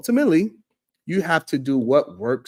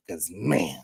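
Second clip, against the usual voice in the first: whisper; 8 semitones lower, 5 dB quieter than usual. Real, not acted. Aside whisper to chat.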